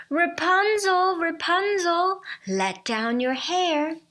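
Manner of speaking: prince copying the witch, high call that fades back down; prince imitating the call. Prince mimicking the enchantress to trick Rapunzel, high call. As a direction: call it up, then ease off. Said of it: "he also went to the tower and said".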